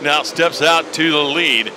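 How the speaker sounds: rising excitement as a driver charges; motorsport commentator. Acted. Live race play-by-play call.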